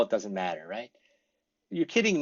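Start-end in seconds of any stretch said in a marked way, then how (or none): 1.7-2.2 surprised you're kidding. Shown as a demonstration. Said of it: "means same as".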